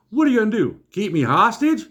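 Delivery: loud, high-pitched indignant outburst; real loud. Retold or imitated. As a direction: imitate an outraged wife making a scene. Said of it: "Real loud".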